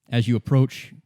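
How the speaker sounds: usual speaking voice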